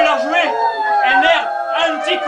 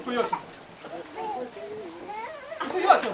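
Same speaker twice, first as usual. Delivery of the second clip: coyote call; 11 semitones higher, much slower than usual. Pretend. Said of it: "Fais le coyote de chevet".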